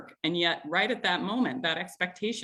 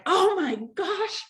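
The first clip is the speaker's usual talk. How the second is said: retold yelling; 10 semitones higher than usual. Mom reenacting her holiday-photo meltdown at the kids, exasperated yell. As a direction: relive the meltdown as a half-acted yell. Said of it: "I yelled".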